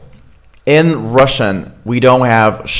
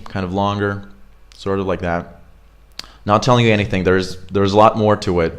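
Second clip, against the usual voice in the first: ordinary delivery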